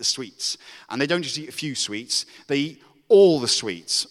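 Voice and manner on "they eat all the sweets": louder, slow, punching each word; emphatic build. A narrator's reading. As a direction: lean hard on all the sweets. Building the sugar-rush story.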